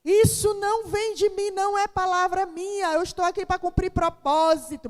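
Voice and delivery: high, loud, fervent declaring; fervent declaration. Retold or imitated. She rebukes her complaint before God, high fervent declaration. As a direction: proclaim it like a rebuke of doubt.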